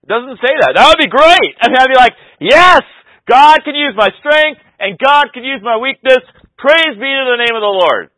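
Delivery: loud, high exultant sermon voice; fired-up preaching. Acted. Preaching at full force.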